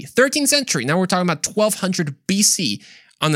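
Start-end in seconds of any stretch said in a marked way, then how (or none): 0.0-0.8 Thirteenth said much higher, stressed; emphatic date. Real, not acted. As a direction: punch Thirteenth up high. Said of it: "stress on date".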